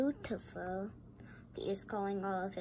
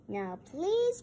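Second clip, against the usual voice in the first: ordinary delivery, nothing marked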